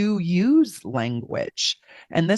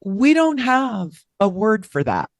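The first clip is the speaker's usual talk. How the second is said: waiter explaining; 5 semitones higher than usual. Retold or imitated. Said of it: "He said".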